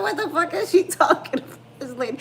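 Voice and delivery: high, loud, laughing disbelief. Retold or imitated. Mom retelling her reaction, high laughing disbelief. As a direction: shriek it while laughing.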